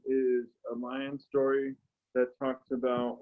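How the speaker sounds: ordinary talk, nothing marked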